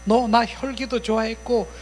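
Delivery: high, insistent arguing; indignant protest. Acted. Evil spirit arguing with its longtime host, indignant protest. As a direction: reproach an old companion in a heated run.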